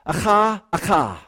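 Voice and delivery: "Acha, acha" with a guttural ch like Hebrew; Hebrew doubled word. Shown as a demonstration. Preacher sounds out the Hebrew verb, careful Hebrew pronunciation. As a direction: say "Acha" twice, throaty kh in the middle, first rising then falling. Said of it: "the next line is two Hebrew words".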